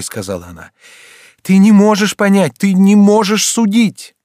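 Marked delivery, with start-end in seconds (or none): 1.4-4.2 loud, very high, impassioned; desperate protest. A narrator's reading. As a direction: cry it out, slowing at the end. Mother pleads desperately with son. (отчаянно, громко)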